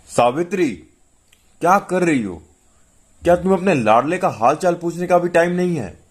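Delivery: high-pitched exasperated call-out; irritated shouting. A narrator's reading. Husband calling his wife to account about their son, exasperated yell. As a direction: call out crossly, pitch well up. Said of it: "तो वो जोर से चिल्लाए".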